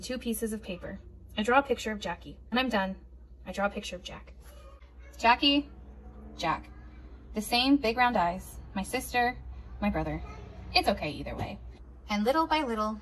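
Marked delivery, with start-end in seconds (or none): none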